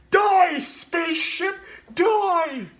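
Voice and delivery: high, booming war shout; Drax battle cry. Retold or imitated. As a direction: bellow it like a warrior charging in. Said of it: "he was like".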